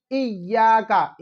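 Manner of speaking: slow इय्याका with held y and long aa; careful drawn-out model of इय्याका; correct doubled y. Shown as a demonstration. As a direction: lean on the doubled y of इय्याका and stretch it. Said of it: "असल में आयत क्या है?".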